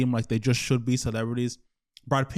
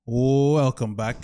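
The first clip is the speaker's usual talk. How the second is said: YouTube intro; 5 dB louder than usual. Acted. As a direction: welcome the viewers like a showman. Vlog intro greeting to viewers.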